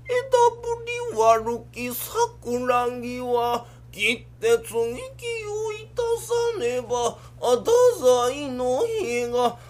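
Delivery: very slow stylised chanting; kabuki recitation. Acted. Son reciting a kabuki line, very slow stylised chant. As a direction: stretch every syllable like a stage lord. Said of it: "台本にないこと言うな".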